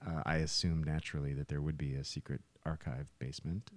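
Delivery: normal talking voice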